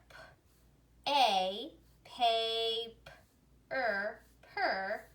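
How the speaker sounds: "P, A" as separate sounds, then blended piece by piece; drawn-out phonics blending of "paper"; sounding out. Shown as a demonstration. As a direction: sound out "P, A, paper, per" with pauses. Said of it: "let's put it together".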